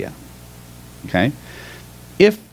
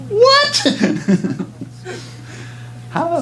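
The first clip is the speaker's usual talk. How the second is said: incredulous. Real, not acted. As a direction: burst out in disbelief, much higher. Laughing at participant's surprising reaction.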